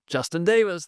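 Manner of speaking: high, excited play call; basketball announcer. Shown as a demonstration. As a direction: call the three-pointer like a PA announcer. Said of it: "I once was a basketball announcer".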